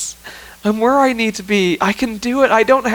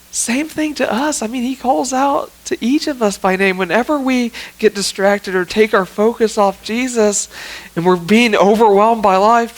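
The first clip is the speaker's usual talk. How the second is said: ordinary talk, nothing marked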